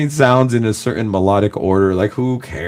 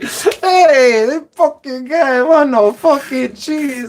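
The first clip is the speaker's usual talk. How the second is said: very high, drawn-out mock yelling; hammed-up imitation of an angry old Italian lady; mock pissed-off lady; much slower than usual. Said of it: "all pissed off".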